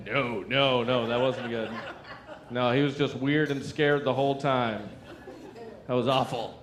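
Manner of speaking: low, soft, deadpan, a touch amused; lightly voiced bored rich man; low, unimpressed rich guy voice. Rich friend answers, low and soft.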